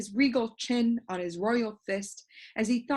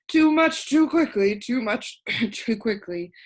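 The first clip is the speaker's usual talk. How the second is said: wheezed; 3 semitones higher, 6 dB louder than usual. A narrator's reading. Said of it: "wheezed the bug".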